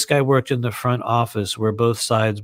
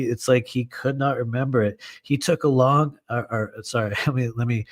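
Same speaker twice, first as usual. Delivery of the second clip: ordinary delivery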